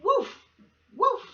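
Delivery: high yappy woofs; dog woof. Shown as a demonstration. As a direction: bark like a small dog. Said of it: "This animal makes this sound".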